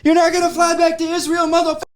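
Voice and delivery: high, screaming threat; furious shout. Retold or imitated. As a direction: yell it like a vengeful chaser. Acting out the fighter's chase.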